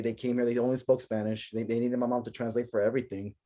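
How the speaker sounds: ordinary talk, nothing marked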